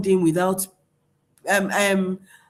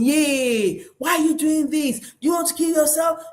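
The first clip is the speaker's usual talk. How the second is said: mock angry shouting; 7 semitones higher than usual. Shown as a demonstration. Parent scolding a self-harming child, mock shouting. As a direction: play a panicking parent yelling at a child. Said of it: "If you start shouting".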